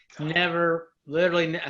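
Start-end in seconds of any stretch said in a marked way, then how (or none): none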